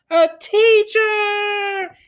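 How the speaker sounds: very high, slow, loud drawn-out answer; class shout. Acted. The class answers their teacher, loud drawn-out cheer. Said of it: "everyone responded".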